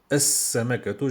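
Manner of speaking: "As-Samakatu" with a long doubled s and no L; as-samakatu, hissing s held; L assimilated. Shown as a demonstration. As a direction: go straight into the s of "As-Samakatu". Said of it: "je vais au sin".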